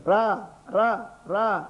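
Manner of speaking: mock mother's repeated short calls; lightly voiced calling, word by word; mother calling child. Mother urging her fallen child to walk over, calling out lightly. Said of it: "తెలిసి ఏం చేస్తుంది?".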